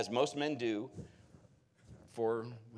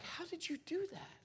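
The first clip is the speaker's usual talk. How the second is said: amazed question; 7 dB quieter than usual. Retold or imitated. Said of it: "I just looked at him like".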